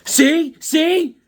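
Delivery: high, loud told-you-so wife; gloating wife. Retold or imitated. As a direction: crow it like the wife proving her point. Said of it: "my wife goes".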